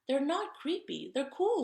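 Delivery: a hint of a kid insisting, rising to cool; bunny defending his cool underwear; protesting kid. A narrator's reading. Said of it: "said Jasper".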